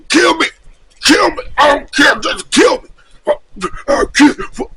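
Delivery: loud, high, frantic begging shout; desperate shout. Retold or imitated. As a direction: scream to be killed, frantic. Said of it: "home was just".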